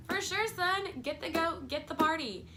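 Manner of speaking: lightly voiced dad saying yes; agreeable dad. Dad granting his son's party request, lightly imitated agreeable father. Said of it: "the dad would have been like".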